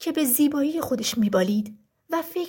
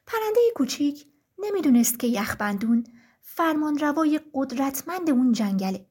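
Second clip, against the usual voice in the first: plain reading voice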